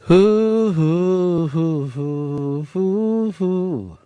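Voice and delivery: rowdy radio hoots; hooting. Real, not acted. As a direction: hoot it out like an owl. Host hooting between calls.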